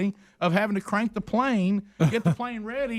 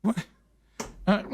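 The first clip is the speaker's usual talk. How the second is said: usual speaking voice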